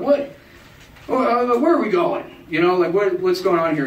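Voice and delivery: high, alarmed questions; rising panic. Retold or imitated. Nervous drunk asking the stranger where they're going, high alarm. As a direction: ask it like you just realised.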